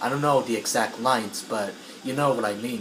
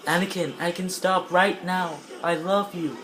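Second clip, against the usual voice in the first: lightly voiced wife, higher pitch; pleading wife; 5 semitones higher than usual. Voicing wife pleading with Anakin.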